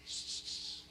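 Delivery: soft breathy shh to quiet a crowd; shushing. Retold or imitated. Hushing the crying crowd at the altar, a soft shh. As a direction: hiss them all quiet.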